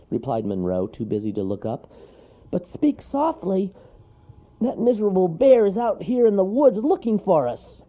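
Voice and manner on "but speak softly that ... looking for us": high, urgent little voice; fussy anxious storybook creature; small character warning. A narrator's reading. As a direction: warn your friend in a squeaky, loud voice. Monroe warns in a character voice.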